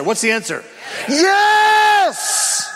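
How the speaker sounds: high, loud call and answer; rally the room to shout back. Real, not acted. Preacher rallying congregation, loud triumphant yes.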